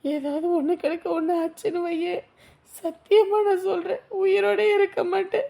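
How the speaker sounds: weep out the fear of shame; sobbing despair. Acted. Girl crying over lost honour. (காதலனிடம் அவமானத்தை நினைத்து அழுது புலம்புதல்)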